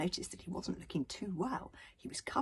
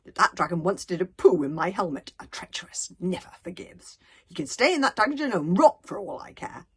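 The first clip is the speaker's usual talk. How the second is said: spiteful; 11 dB louder than usual. A narrator's reading. Alvin's spiteful refusal.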